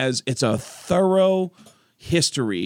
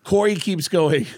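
ordinary talk, nothing marked

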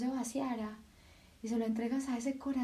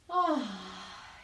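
long sighing breath out; audible exhale; 3 semitones lower than usual. Shown as a demonstration. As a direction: let the air go with an ah. Breathing exercise, exhale sound. (exhalación con suspiro)

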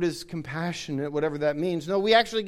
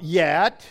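"yet" punched louder and set apart; stress on yet; 5 dB louder than usual. Real, not acted. Preacher pointing out the tacked-on word, "yet" stressed. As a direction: hit "yet" hard as a word on its own.

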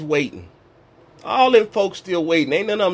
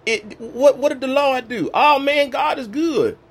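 casual churchgoer voice; 8 semitones higher than usual. Retold or imitated. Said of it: "I'm like".

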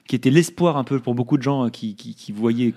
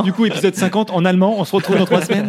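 amused, pitched up through a laugh; laughing, high-pitched; 7 semitones higher, 4 dB louder than usual. Real, not acted. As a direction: toss out the joke while laughing. Joke announcement amid laughter. (en riant, voix aiguë)

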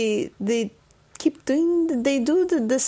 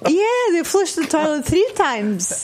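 laughing through the words; real laughter bubbling up as she answers; 7 semitones higher, 4 dB louder than usual. Real, not acted. Absurd neighbour story, laughing.

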